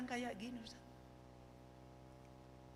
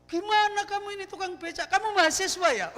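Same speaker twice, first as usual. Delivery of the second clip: higher, louder scolding of the becak driver; angry passenger; 11 semitones higher, 10 dB louder than usual. Retold or imitated. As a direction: tell the clumsy driver off in a fury. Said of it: "Marah habis itu orang itu". (penumpang marah membentak tukang becak)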